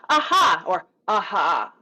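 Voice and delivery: off-balance demo of aha two ways; exaggerated counter-example; wrong, unanchored aha. Shown as a demonstration. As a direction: overdo it, then let it slump. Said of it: "both of those take me out of".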